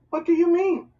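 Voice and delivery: high, loud alarmed question; a boy shocked by his mother's words. A narrator's reading. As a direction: burst out with alarm. Boy alarmed, demanding answer.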